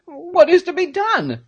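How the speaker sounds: high, anxious character voice; panicked Peterkin cry. A narrator's reading. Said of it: "cried Peterkin".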